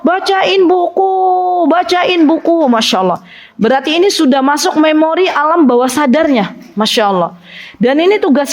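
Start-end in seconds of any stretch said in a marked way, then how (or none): 0.0-2.7 put-on a child's mumbled night request, slow; half-asleep child asking for a book; sleepy child asking. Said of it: "bangun tidur ... di tengah malam". (anak setengah tidur minta dibacakan buku)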